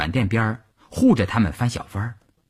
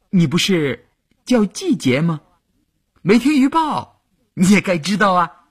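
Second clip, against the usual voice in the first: teasing; 10 semitones higher, 4 dB louder than usual. A narrator's reading. Teasing the girl.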